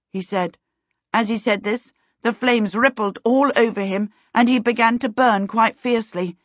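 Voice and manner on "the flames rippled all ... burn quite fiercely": ordinary delivery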